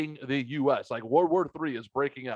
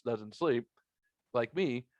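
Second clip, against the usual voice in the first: ordinary talk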